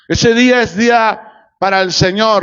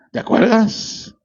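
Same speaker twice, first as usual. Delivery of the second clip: devil's reminder; 4 dB quieter than usual. Acted. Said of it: "el diablo te lo recuerda".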